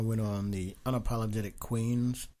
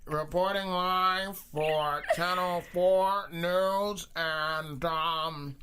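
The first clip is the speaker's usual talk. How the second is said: lightly voiced old-time news reporter; slow announcer-style live report; 1950s reporter voice; 5 semitones higher than usual. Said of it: "that's my reporter voice from the nineteen fifties".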